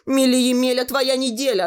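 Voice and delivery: high, loud snapping rhyme; cutting retort. Acted. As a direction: snap back the rhyme. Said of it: "отрезала Танька". (резко, громко и высоко отрезает)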